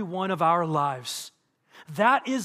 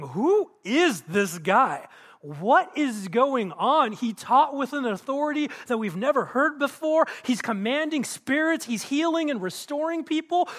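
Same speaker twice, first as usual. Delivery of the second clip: acted amazement; 5 semitones higher, 3 dB louder than usual. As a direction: play a stunned onlooker, building in pitch. Acting out an astonished congregation.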